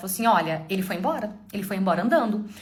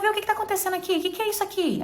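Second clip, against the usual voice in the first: imitating his shocked outburst, much higher and fast; shocked husband; 11 semitones higher, faster than usual. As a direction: blurt it out as the stunned husband. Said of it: "Ele falou". (marido chocado, voz bem mais aguda e rápida)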